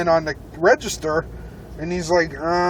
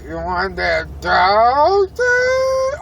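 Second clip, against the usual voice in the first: mock cashier voice; 3 semitones higher, 3 dB louder, much slower than usual.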